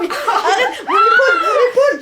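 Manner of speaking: shrieking about a sore shoulder; laughing yelp of pain; pained laughing shout. Real, not acted. Shoulder hurt during exercise. (cri de douleur en riant)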